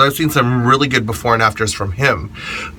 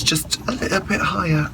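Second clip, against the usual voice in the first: higher, softer British accent; lightly British, pitched up; accidental British accent; 7 semitones higher, 6 dB quieter than usual. Real, not acted. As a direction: slip briefly into a British accent. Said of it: "suddenly, suddenly I became British".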